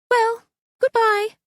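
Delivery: high, light and slow; airy goodbye. Acted. Daughter leaving her quarrelling parents, airy and light. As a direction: float the goodbye off carelessly. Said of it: "then added airily".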